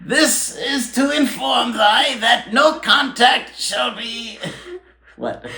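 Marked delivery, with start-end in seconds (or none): 0.0-4.4 put-on high, slow old British town crier proclamation; hammed-up old British herald reading a decree; mock town crier British accent. Hosts mock the breakup text as a town crier proclamation, high and pompous British. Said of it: "thinking about it all in the accent".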